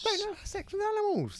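squeaky high public-service warning; high mock voice. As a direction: say it like a prim announcement.

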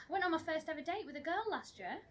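excited. Shown as a demonstration. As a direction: sell it like big news, with a lift on "went". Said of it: "You're not as excited as I was".

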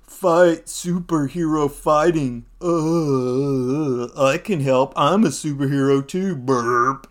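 put-on slurred, dragged-out drunken hero. Drunk Tony offering to join the fight, slurring. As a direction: slur it with a mock burp at the end. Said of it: "'Cause he's all drunk".

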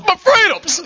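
high shouted mock patriot; mock freedom shout. Mocking the internet commenters with a high shout.

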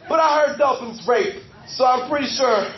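ordinary talk, nothing marked

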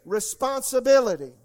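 loud, high, slow declaimed prayer in phrases; led prayer. Real, not acted. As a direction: proclaim it phrase by phrase for a congregation to repeat. Leads congregation in repeat-after-me prayer.

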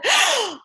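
a quick mock gasp from the daughter; retold gasp. Daughter hearing about the Oreos, mock gasp. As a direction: gasp as if caught out. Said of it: "She said".

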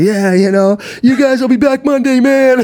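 high-pitched, hyped-up retold quote; acted excited announcement. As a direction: imitate yourself hyped on air. Cheerful remark acted on TV appearance.

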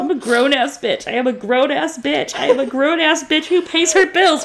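high, loud tearful rant; half-laughing through tears. Real, not acted. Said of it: "Why am I crying".

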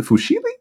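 high, questioning "Fusilli" as foo-SHEE-lee; questioning mispronunciation. Real, not acted. Asking co-host how it's said, high foo-SHEE-lee for "Fusilli". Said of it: "how do you say it".

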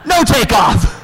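high, loud mock announcement; comic shouted punchline. Real, not acted. Dad reports toddler's failed jump, shouted punchline. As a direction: shout the failure for laughs.

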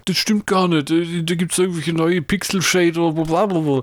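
whiny know-it-all nerd, lightly voiced. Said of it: "Jetzt würden die Nerds sagen".

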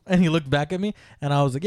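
usual speaking voice

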